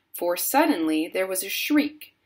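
ordinary delivery

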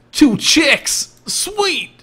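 very high, gleeful outburst; hammed-up high-pitched delight; mock excitement. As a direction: squeal it like a hyped-up bro. Exclaims in game.

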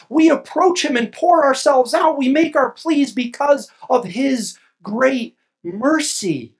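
fervent sermon peak; preaching at full force. Acted. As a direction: pour it out like a pulpit climax. Preaching builds to climax.

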